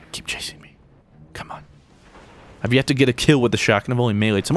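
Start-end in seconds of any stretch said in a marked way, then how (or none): 0.1-1.7 whispered taunt, much softer and lower; sneaky whisper. Acted. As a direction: whisper to lure the enemy after you. Luring enemy, whispering taunt.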